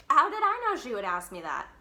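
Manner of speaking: ordinary delivery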